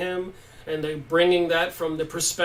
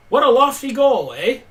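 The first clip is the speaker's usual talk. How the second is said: mock Canadian accent, louder and higher, leaning on the eh; 7 semitones higher, 9 dB louder than usual. Joking about Ramtha's words, a mock Canadian accent. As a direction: tease it like a Canadian with a big eh. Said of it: "a little Canadian accent, eh?".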